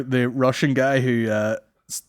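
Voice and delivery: ordinary talk, nothing marked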